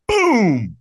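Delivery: drawn-out sound-effect boom; booming burst breaking the narration; sudden boom. Acted. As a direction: hit it like an explosion. Sudden impact in his story.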